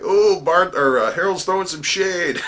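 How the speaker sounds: high, loud, gleeful crow; cackling heckler. Retold or imitated. Audience member in the front row teasing the herald, high gleeful cackle. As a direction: imitate a tickled onlooker calling out. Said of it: "was laughing and cackling, going".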